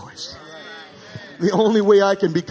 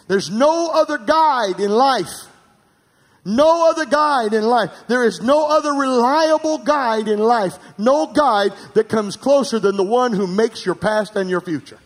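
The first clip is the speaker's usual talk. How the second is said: preaching cry; 6 semitones higher, 4 dB louder than usual. Acted. Pastor proclaiming God as the only guide, loudly. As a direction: ring it out to the back pews.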